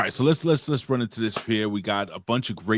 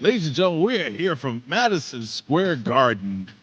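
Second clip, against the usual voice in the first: announcer voice; 4 semitones higher than usual. Acted. Announcer intro again.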